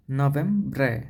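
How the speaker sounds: নভেম্বরে with a rolled r, no-VEM-bre; Italian Novembre. Shown as a demonstration. Teacher shows the rolled r in Italian November. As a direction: model নভেম্বরে, r trilled. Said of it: "আরটা হচ্ছে র উচ্চারণ হবে".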